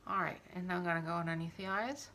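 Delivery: ordinary talk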